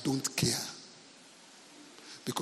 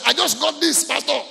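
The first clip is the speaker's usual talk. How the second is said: put-on eager young man; 11 semitones higher, 8 dB louder than usual. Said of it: "and he said".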